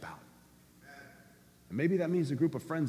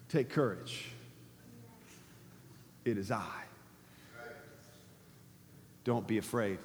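gently voiced Jesus, soft and low; calm reassurance; 6 semitones lower, 10 dB quieter than usual. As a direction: lower and softer, steady comfort. Jesus voiced calming the disciples.

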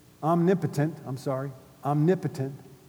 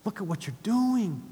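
soft, hushed lament; sad whisper; 3 semitones higher, 6 dB quieter than usual. Acted. Holy Spirit sadly watching the unforgiving servant, hushed. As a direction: grieve quietly over what they're doing. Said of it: "Holy Spirit's just going".

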